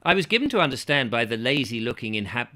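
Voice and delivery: ordinary delivery, nothing marked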